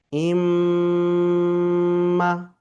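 ইম্মা with a long, steady nasal hum on the mm; ghunna. Shown as a demonstration. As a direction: hold the ম্ম in ইম্মা very long through the nose, on one pitch. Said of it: "গুণনার পরিমাণ এক আলিফ বরাবর". (ইম্মা-র ম্ম নাকে টেনে গুন্নাহ করে)

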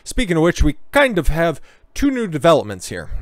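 ordinary delivery

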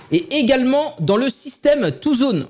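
usual speaking voice

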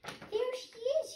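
very high and slow, hopeful; a chick thinking it found mother; baby bird voice. Acted. Baby bird seeing the big thing, high and slow. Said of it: "my baby bird voice".

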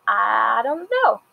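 slowly stretched, mock-clueless reply; drawn-out answer. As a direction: stretch out the words, imitating an evasive answer. Retold evasive answer to question.

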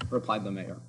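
plain reading voice

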